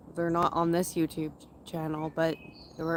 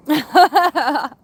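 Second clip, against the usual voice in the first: loud laugh; 9 semitones higher, 13 dB louder than usual. Real, not acted. Laughing at own rudeness.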